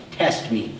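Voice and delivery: dropped low and soft, Test me; hushed. Shown as a demonstration. Preacher quoting God's dare to the church, hushed and low. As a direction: let the line fall to a near hush. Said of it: "the only place in Scripture that I can find where God says".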